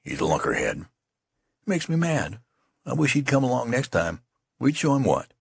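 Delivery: sudden fed-up outburst, rising high; exasperated. Acted. Youth vents about the general: mild exasperated outburst. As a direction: snap out your irritation. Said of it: "exclaimed with sudden exasperation".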